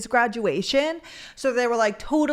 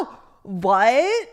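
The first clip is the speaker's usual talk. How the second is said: shocked what; 5 dB louder than usual. Real, not acted. Shocked reaction to the DM.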